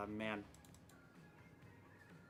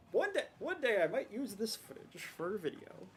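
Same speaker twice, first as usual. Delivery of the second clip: higher, hammed-up dreamy hope; mock hopeful; 7 semitones higher than usual. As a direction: oversell the daydream. Mocking own hopeful self.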